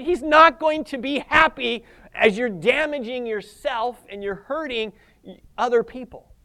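usual speaking voice

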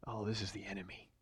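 voicing the thought under his breath; inner realization. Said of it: "And he knew".

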